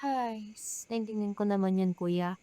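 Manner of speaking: hushed, letting her guard down; softened reply. Acted. Sister answering her apologizing brother, softly.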